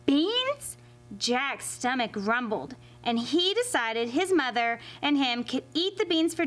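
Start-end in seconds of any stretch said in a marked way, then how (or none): 0.0-0.8 surprised question. Acted. Jack surprised at the offer.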